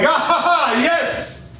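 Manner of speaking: high, gleeful laughing yes; excited laugh. Acted. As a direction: burst out with delighted glee. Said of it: "George is like".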